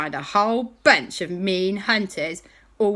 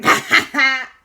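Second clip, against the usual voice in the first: high, loud burst of giggling; cheeky laugh; 8 semitones higher, 9 dB louder than usual. Acted. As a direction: laugh at your own prank. Boys laugh at their joke.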